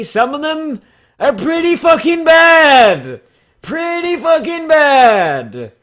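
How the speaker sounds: high, loud, drawn-out shriek of disgust; mock outrage. Real, not acted. YouTuber ranting about bad pony OCs, shrieking loud and high. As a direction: wail it in exaggerated horror. Mocking terrible OC backstories.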